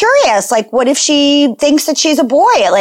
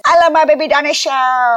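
imitating a little kid, higher pitched; toddler voice; 5 semitones higher than usual. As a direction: gush like a toddler with "dinosaur" as dino-shah. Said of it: "And then she says".

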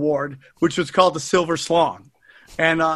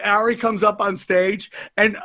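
ordinary delivery

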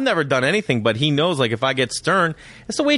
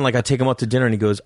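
normal talking voice

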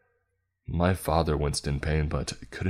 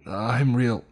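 higher, louder desperate cry; high, loud plea from a distraught mother; 7 semitones higher, 5 dB louder than usual. A narrator's reading. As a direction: cry out in distress, cut short. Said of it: "My mother's cries were interrupted".

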